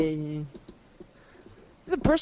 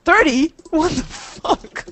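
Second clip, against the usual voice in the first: shocked outrage; 7 semitones higher, 4 dB louder, much slower than usual. Real, not acted. Told she sounds thirty.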